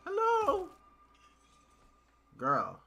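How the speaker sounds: high, loud sing-song call. Real, not acted. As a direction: shout hello at her. Calling to game character.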